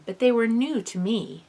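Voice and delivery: ordinary delivery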